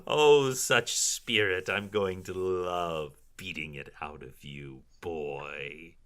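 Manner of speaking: wicked villainess, drawn-out and pleased; gloating drow matron. Acted. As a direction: savor the threat after a cackle. Cackling matron threatens captive.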